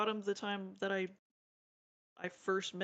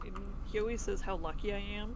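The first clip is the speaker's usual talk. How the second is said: ordinary delivery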